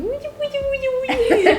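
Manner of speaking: put-on high squeaky baby talk to a pet. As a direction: coo at an animal in a tiny high voice. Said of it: "my baby voice with animals".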